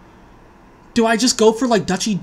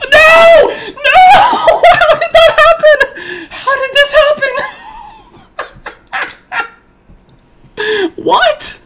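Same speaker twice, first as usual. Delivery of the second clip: very high, loud shrieks of disbelief; screaming laughter; 9 dB louder, much slower than usual. Real, not acted. Disaster draw, screaming laughter.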